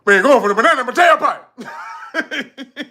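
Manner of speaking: loud, laughing Eddie Murphy quote; Eddie Murphy style line. Retold or imitated. Streamer redoes the Eddie line, cocky and laughing. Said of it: "You supposed to say it like this".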